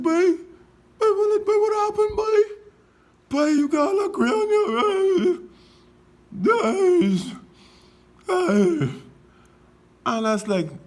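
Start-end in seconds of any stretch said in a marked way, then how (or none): 0.0-5.4 high squeaky exaggerated voice of a stranger; mocking high voice. Stranger gawking at his grey hair, high mock squeal. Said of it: "They saw me and they said". 6.3-9.0 high squeaky exclamations; mock passerby. Passerby admiring his grey hair, high squeal. Stranger voiced, reacting.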